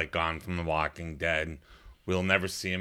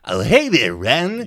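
loud, high cartoon greeting; goofy Stimpy voice, pitched up and pushed out; cartoon Stimpy impression; 7 semitones higher, 11 dB louder, slower than usual. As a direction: call out like a dopey cartoon sidekick. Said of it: "how was Stimpy".

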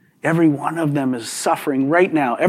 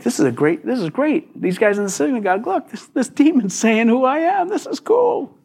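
rising higher, gleeful and quick; imitating eager excitement; 7 semitones higher than usual. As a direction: hype yourself up like it's a big break. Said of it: "it was like".

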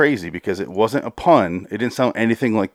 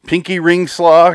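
mocking pun; 7 semitones higher, 6 dB louder, slower than usual. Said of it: "You might as well say".

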